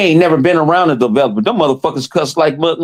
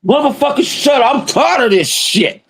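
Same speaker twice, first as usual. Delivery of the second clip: quoting an angry outburst; 9 semitones higher than usual. Retold or imitated. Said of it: "Hard Geek was".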